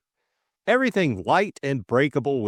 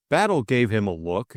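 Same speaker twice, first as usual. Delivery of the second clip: usual speaking voice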